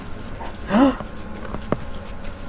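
high breathy intake; startled gasp; sharp frightened gasp. Real, not acted. Startled in game.

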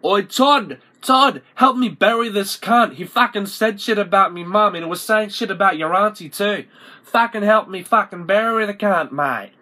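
loud, high bogan holler; urgent calling out. Acted. Calling a mate to help bury a body, loud urgent yelling. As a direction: holler for help in a hammed-up panic.

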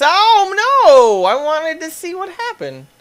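high, loud oh no; startled outcry. Real, not acted. Player losing the story scene, high startled cry. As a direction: cry out in surprise.

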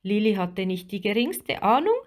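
plain reading voice

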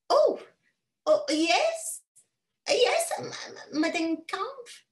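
high, stammering English governess; flustered governess. Acted. Governess flustered answering madame, high and stammering. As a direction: stumble over the words, eager.

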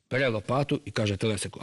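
plain reading voice

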